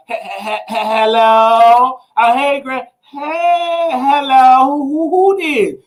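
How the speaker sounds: put-on high, halting old-lady hello; imitating granny. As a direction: stutter hello like a grandma straining to hear. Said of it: "She gonna be like".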